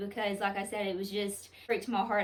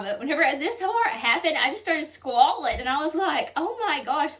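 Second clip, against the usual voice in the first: fighting back real tears while telling it; choked up, near tears; 6 semitones higher, 8 dB louder than usual. Real, not acted. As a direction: voice rising high and loud with emotion. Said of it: "I'm gonna try not to cry".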